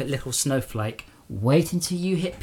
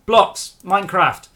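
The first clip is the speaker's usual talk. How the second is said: mock troll; 5 semitones higher, 7 dB louder, slower than usual. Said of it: "If you look at a game and go".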